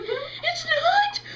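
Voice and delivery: very high, loud mock cry; tearful wail. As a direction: squeal it out in exaggerated distress. Acted distress to mother.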